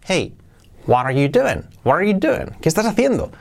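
lively "what are you doing?" led in by "Hey"; melodic, pointed "what are you doing?"; with intonation. Shown as a demonstration. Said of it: "ahora sí le damos entonación".

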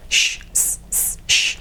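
unvoiced "Sh, s, s, sh", each sound separate; isolated sh and s. Shown as a demonstration. Teacher breaking a phrase into its sounds, isolated sh and s. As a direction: hold "sh" and "s" as pure breath sounds. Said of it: "nos quedan estos sonidos".

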